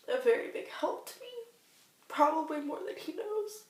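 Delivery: really starting to cry mid-sentence; choked up with gratitude; tearful. Real, not acted. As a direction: voice climbing high into tears. Breaking down thanking daughter's dad.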